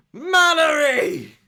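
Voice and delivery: high, drawn-out greeting shout; crowd's cheer. Acted. Soldiers hail the granny as she walks in, cheering shout.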